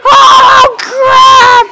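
high, loud, stretched-out cry; drawn-out panicked yell; panicked scream. Real, not acted. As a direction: scream it in alarm. Attacked by the upset meower.

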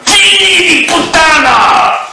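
high, loud, slow drawn-out yell; screeching involuntary curse; shouted swear. Pretend. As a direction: stretch the curse out at full volume. Mock tic outbursts of swearing.

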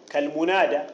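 ordinary talk

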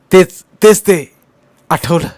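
loud, high burst of recognition; excited outburst as it comes back; sudden recollection. A narrator's reading. Sick man suddenly remembers the word.

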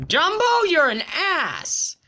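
high, loud insult; high outraged shout; outraged yell. Real, not acted. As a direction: yell at the rival. Insulting rival player.

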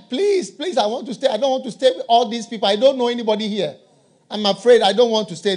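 high, quick desperate begging; pleading grandfather. Retold or imitated. Grandfather begging son at home, high hurried plea. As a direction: plead fast like a scared old man.

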